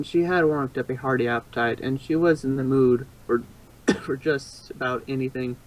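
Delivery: plain reading voice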